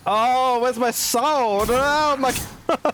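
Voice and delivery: high, loud, drawn-out panic with a laugh; shrieking at the screen as an enemy closes in; panicked squeal. Real, not acted. Gamer panics mid-fight, high loud laughing shriek.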